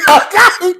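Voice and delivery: high, loud mock-crying scream; hammed-up tearful meltdown, shrieked; Candy crying and fighting. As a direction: yell threats through put-on sobs. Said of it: "Sounded just like Candy. Bitch, we crying and fighting".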